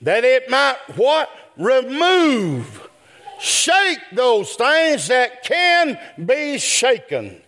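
high, slow shouted climax; full-voiced sermon cry; preaching shout. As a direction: shout it out to the church. Sermon climax, congregation responds.

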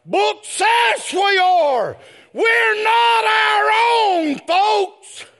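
full-force sermon cry; preaching shout. Real, not acted. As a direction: declare it to the pews.

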